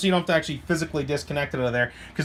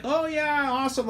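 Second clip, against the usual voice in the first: mock enthusiasm; 8 semitones higher than usual. Reviewer imitating a gushing reaction to every brand, mock enthusiasm. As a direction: hype it up in a higher voice.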